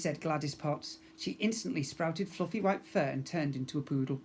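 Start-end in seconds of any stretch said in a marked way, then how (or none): none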